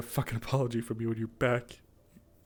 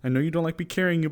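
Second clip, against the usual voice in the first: normal talking voice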